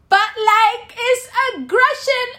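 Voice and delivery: squeaky mock outrage; mocking whine. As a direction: parody the pro-NATO crowd. Mock voice of opponent.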